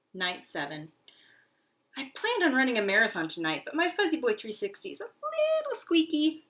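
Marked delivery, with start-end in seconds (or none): 2.0-6.4 high, loud fast brag; lively squeaky hamster; eager hamster. Acted. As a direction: rush out the plan. Hamster complains.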